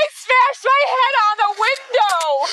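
high, loud distressed protests; upset shouting. Real, not acted. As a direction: plead and accuse at once. Distressed during arrest.

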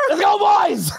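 high, loud rallying yell; excited shout. Retold or imitated. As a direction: shout it to fire the team up. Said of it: "I was like".

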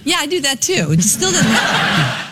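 laughing through the reply. Real, not acted. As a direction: giggle while admitting it. Laughs at the drinks suggestion.

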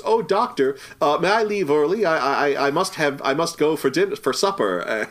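high, flustered nurse voice; a hammed-up flustered nurse. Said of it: "opens a door and says".

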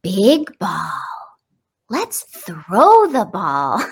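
slow, exaggerated sing-song to a baby; sing-songy parentese. Shown as a demonstration. Parent modeling simple words for a little one, sing-songy parentese. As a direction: stretch each word out with big swoops. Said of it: "almost like a sing-songy, exaggerated speaking style".

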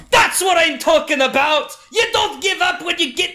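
high, loud roar of approval; rowdy triumphant shouting. Acted. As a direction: holler like a thrilled tavern brawler. Antar loudly cheers on his opponent.

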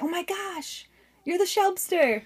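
high mock fan recognition; imagined starstruck fan. Imagined stranger recognizing her, mock-starstruck.

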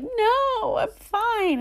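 very high, strained crying voice; tearful denial. Retold or imitated. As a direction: insist you're fine while crying hard. Crying with this backpack.

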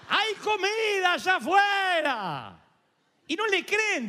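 high, drawn-out call from far off; slow mock shout. Lepers calling to people inside the walls, a high slow mock shout. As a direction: stretch the shout out so the city hears it. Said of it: "gritan de afuera y dicen".

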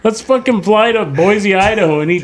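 high, excited hype man; hyped crony voice. Retold or imitated. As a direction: cheer him on like a fawning sidekick. Voicing [name]'s cronies.

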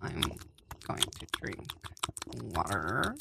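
soft hushed whisper. Pretend. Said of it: "take it down to a whisper".

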